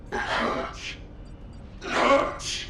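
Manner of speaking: ragged, breathy cries of pain; anguished, half-voiced wailing; pained cry. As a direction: cry out in agony with a broken voice. Anguished voice in recovered recording.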